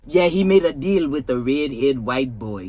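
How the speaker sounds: put-on low, slow Spanish accent. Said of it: "gave you a little Spanish accent on it".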